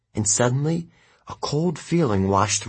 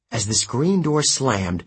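plain reading voice

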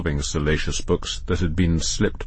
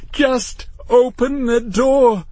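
very high, slow, desperate plea; pleading; 4 dB louder, much slower than usual. A narrator's reading. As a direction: beg with each word drawn out. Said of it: "Matthew pleaded".

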